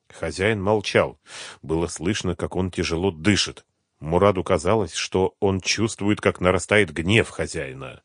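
ordinary delivery

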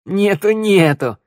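loud, high, drawn-out mockery; scoffing. A narrator's reading. Shnyryala mocks the mayor's revolver, scoffing. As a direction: jeer at his empty threat. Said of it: "хмыкнула шныряла".

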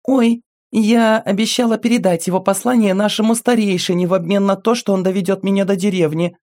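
put-on high, bright child voice; little girl voice. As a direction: speak as a small girl telling her story. Little girl character speaking.

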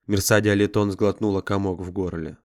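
usual speaking voice

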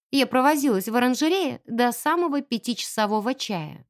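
plain reading voice

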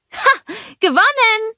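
very high, loud victory call; triumphant cry. A narrator's reading. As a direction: crow over winning the race. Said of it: "rief sie". (hoher, triumphierender Jubelruf)

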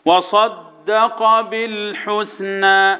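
slow, measured tajweed chant; Quran recitation. Shown as a demonstration. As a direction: intone the verse with weighted doubled letters. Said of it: "والدال برضه شدها وانا معدي".

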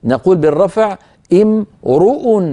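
امرؤ as imruu, the r clearly rounded; r with damma. Shown as a demonstration. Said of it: "لاحظوا بأن الراء مضمومة".

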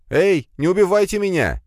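high, loud panicked cry; frightened shout. Acted. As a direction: call inside in panic. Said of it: "крикнул внутрь".

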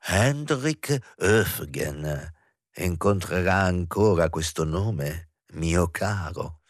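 drawn out and teasing; slow, savoring the name. Acted. Actress to professor, slow and teasing. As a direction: let each word linger, knowingly. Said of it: "rise la Martin".

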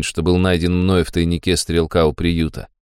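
ordinary delivery, nothing marked

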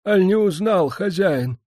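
put-on old beggar's voice, high and anxious; agitated beggar cry. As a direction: cry out, hoping to be known. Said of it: "взволнованно вскрикнул нищий". (нищий взволнованно и высоко вскрикивает)

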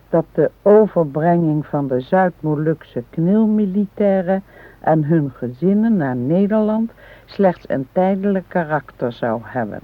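careful, deliberate reading from a book; reading aloud. Acted. Wife reads a history book aloud to her husband. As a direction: read the passage out to her husband.

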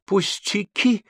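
high, slow, effortful word before fainting; speaking with difficulty. A narrator's reading. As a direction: force it out slowly, high-pitched. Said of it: "с трудом произнесла Виола". (с трудом произносит, теряя силы)

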